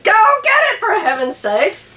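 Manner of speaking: high, exasperated push to go get it; exasperated urging. Retold or imitated. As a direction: shoo him off, half laughing in disbelief. Urging husband to fetch card.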